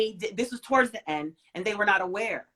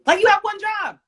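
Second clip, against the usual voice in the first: much higher, louder, fed up; exasperated outburst; 12 semitones higher, 10 dB louder than usual. Real, not acted. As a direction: hit it like a furious complaint. Scolding the anesthesiologist, emphatic repeat.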